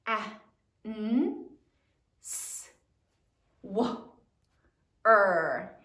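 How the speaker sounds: "A, n, s, w, er" as isolated sounds, W voiced; sounding it out. Shown as a demonstration. Teacher sounds out answer for the class, sound by sound. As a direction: give each sound in "A, n, s, w, er" alone, with pauses. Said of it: "Sound it out".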